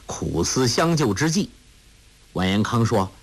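ordinary delivery, nothing marked

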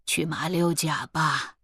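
quietly firm elder; understated, settled insistence. A narrator's reading. Elder urging the young one to go to Malacca, mildly firm. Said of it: "老祖语气坚定".

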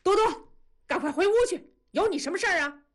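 father roars at the daughter to leave; loud bark. A narrator's reading. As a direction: bark her off to her room. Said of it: "同志大喝一声".

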